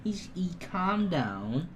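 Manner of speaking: calm the dog down slowly; soothing. Real, not acted. Praising calm puppy.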